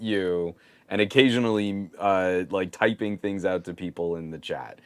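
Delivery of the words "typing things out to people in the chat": usual speaking voice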